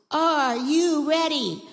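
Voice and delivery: slow, loud, high hype call; big drawn-out rallying question. Real, not acted. Rallying the children before yelling.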